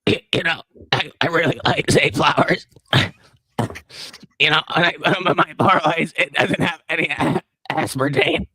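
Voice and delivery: strained, halting politician impression; choked, faltering put-on voice; RFK. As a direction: push each word out haltingly, voice catching. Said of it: "Is that not a good R F K impression?".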